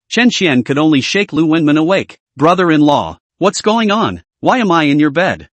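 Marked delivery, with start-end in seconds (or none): none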